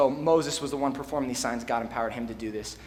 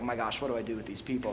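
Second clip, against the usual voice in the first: softer, quicker exasperated sigh of a line; 4 semitones lower, 6 dB quieter, faster than usual. Retold or imitated. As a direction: throw it away like a fed-up leader. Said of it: "I understand Moses' complaint here".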